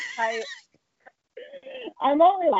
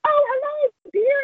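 high-pitched British grandmother greeting; British accent; slower than usual. Retold or imitated. Ex sister-in-law's grandmother greets a visitor, high British accent. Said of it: "in this British accent".

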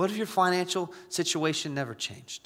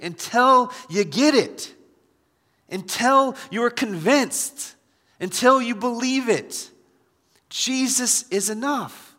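loud and high, slow emphatic preaching; full-force preaching; 9 semitones higher, 6 dB louder than usual. Acted. Pastor drilling the refrain into the congregation, loud and slow. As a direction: build it like a rising refrain.

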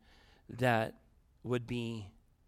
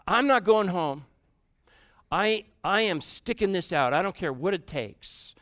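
defiant vow; 3 semitones higher, 6 dB louder than usual. Retold or imitated. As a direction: vow it like slamming the phone down. Defiant vow after slamming phone.

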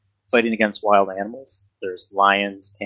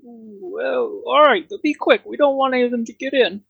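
much higher, dopey guard; high dim guard voice; 13 semitones higher than usual. Acted. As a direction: pitch way up like a dumb guard.

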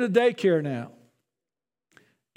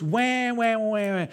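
imitated whiny baby crying, fading off; mock baby wail; slower than usual. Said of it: "we know what babies do".

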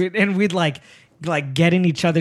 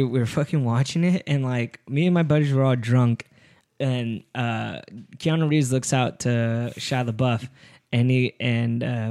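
ordinary talk, nothing marked

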